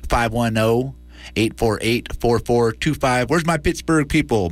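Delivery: usual speaking voice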